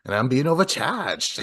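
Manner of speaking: put-on Boston accent of a griping caller. East Coast cable customer complaining to the help line, Boston accent. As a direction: do a Boston complainer, dropping the r's. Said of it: "they had that fucking accent, you know, kinda Boston".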